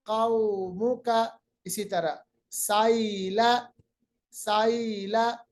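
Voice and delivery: साइला slowly, ai split soft; soft drawn vowel. Shown as a demonstration. Teacher models साइला slowly for students. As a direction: say साइला gently, not सैला. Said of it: "ये सैला नहीं है".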